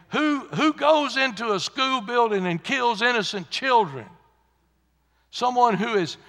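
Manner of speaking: high, loud indignant question; outraged preaching. Real, not acted. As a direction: cry out in disbelief from the pulpit. Outraged question about killing children.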